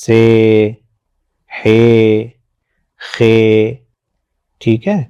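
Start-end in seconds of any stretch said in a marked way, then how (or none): 0.1-3.7 each name said slowly on its own, ending in a long "e" vowel; name each letter, pausing between, every one ending in "e"; Urdu letter names. Shown as a demonstration. Teacher modelling Urdu letter names, each ending in a long "e". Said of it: "ہم ان کے ساتھ بڑی یہ لگا کے بولتے ہیں".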